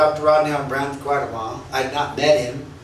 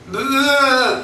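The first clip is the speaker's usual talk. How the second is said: higher-pitched, drawn-out "blah" of someone unable to talk; tongue-tied babble; 7 semitones higher than usual. Retold or imitated. Preacher imitating himself before a famous minister, tongue-tied babble. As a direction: imitate a helpless wordless blurt. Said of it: "What is wrong? I can't talk".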